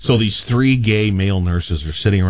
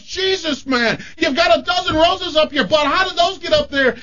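high, yelled astonishment; shocked doctor. Acted. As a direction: shout it in utter disbelief. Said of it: "he goes".

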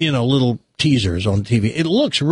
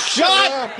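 mock shout. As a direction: yell it to silence the couple. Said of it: "Yeah, like".

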